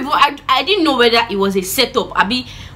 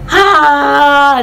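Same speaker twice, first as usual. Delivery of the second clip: loud, higher drawn-out mock wail; scream; 5 semitones higher, 7 dB louder than usual. Child flogged by mom, long imitated scream. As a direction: let out a long retold yell of pain. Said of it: "I screamed and I was like".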